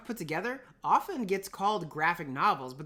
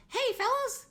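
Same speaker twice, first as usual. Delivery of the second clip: much higher, bright mock hello; mock cheery greeting; slower than usual. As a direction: squeak it like an eager kid. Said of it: "One day you're like".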